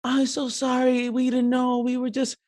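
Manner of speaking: put-on high, slow apologetic girl voice; little girl. Little girl apologizes to the man she hit, high pleading voice. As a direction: plead in a high small child's voice. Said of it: "the little girl was like".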